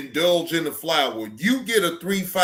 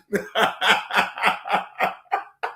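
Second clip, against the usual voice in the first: breathy laugh; 4 semitones higher than usual. Real, not acted. Laughing at own joke.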